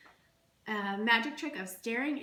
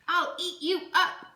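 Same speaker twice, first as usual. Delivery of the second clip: high, loud playful monster threat; Max's wild threat; 9 semitones higher, 7 dB louder than usual. Acted. Reading to a child, Max threatens his mother playfully. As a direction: roar it up at the end like a wild boy. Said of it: "and Max said".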